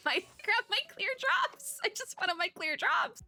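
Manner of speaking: very high, pouty mock whine; mock whining. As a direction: whine like a thwarted little kid. Playfully complains about wanting drops.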